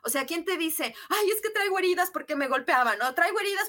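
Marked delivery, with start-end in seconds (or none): 1.1-3.7 raised pitch, louder plaintive parent's complaint; mock wounded parent. Coach imitating parents in her workshop, plaintive and raised. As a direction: voice a hurt parent pouring out old wounds. Parents voiced telling their hurts.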